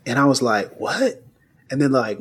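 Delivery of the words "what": stunned what. Retold or imitated. Finding money in the album.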